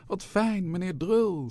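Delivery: high, gushing greeting; effusive high-pitched host; delighted welcome. A narrator's reading. Museum staff greet a film-star guest.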